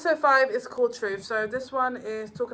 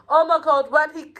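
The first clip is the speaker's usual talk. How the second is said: loud, higher burst of excitement; excited; 7 semitones higher, 11 dB louder than usual. Real, not acted. Fan reliving a big fight scene, loud awe. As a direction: blurt it out in awe.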